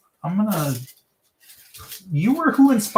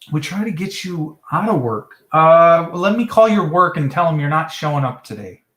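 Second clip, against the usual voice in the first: usual speaking voice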